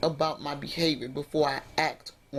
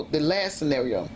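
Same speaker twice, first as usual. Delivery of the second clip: usual speaking voice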